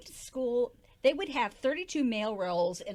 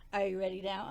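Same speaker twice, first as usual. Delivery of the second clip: usual speaking voice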